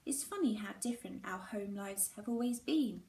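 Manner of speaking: ordinary delivery, nothing marked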